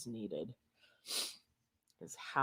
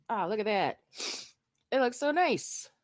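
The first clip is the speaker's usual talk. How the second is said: high, loud and thrilled; delighted; 8 semitones higher, 10 dB louder than usual. Real, not acted. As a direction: gush over how nice it looks. Admiring finished glued piece.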